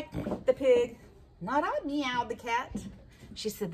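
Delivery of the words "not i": cat voice. Acted. Cat refuses high.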